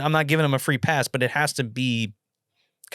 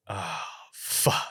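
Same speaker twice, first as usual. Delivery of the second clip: low, quiet retold curse; hushed, sinking delivery of a quoted reaction; dismayed mutter; 7 semitones lower, 9 dB quieter than usual. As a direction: sigh it out softly, imitating dismay. Retold reaction to whispered news in court.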